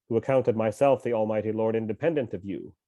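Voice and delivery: usual speaking voice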